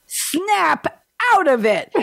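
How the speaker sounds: high, slow, hammed-up Bronx snap; pretend Bronx accent. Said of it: "I did my pretend Bronx accent".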